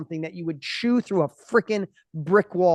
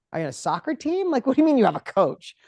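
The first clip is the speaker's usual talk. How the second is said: pitched-up, louder mocking disbelief; incredulous mock question; 7 semitones higher, 6 dB louder than usual. Dinner guest scoffs at someone having a coach, mock disbelief.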